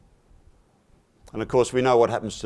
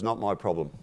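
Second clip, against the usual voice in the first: soft, flat-out shrug of a line; lightly voiced cow, not interested; understated dismissal; indifferent cow; 3 semitones lower, 7 dB quieter than usual.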